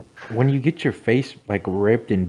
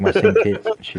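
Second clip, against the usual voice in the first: high joking voice; 11 semitones higher, 4 dB louder than usual. Pretend. Joking self-comparison, high voice.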